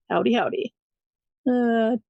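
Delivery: normal talking voice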